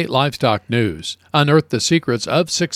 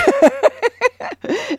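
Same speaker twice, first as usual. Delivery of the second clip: laughing. Real, not acted. Laughs at joke.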